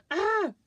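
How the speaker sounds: short, high, loud "ah!"; mock yell. Imitating the huzzah guys' cry, high mock yell. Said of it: "'Cause they go".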